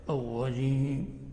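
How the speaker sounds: الرجيم with a tapless, swallowed ر, very slow; R with trill removed. Retold or imitated. Teacher imitating some eastern reciters, weakened ر in الرجيم. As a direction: imitate reciters who erase the ر of الرجيم, ī held long. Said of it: "كما يفعل بعض المقرئين مشارق".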